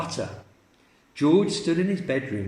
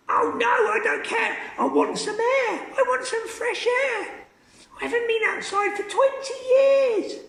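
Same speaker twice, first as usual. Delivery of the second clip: put-on screechy old voice. Grandma calling from the attic, very high screech. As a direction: screech it from above.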